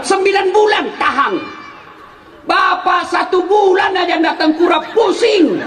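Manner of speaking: preacher rousing the men; fervent preaching. Real, not acted. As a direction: press the husbands hard. Preacher exhorting husbands.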